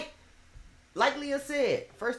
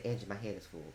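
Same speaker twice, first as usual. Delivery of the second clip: quiet plea; 9 semitones lower, 10 dB quieter, faster than usual. Retold or imitated. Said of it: "I said".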